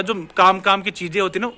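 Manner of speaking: ordinary talk, nothing marked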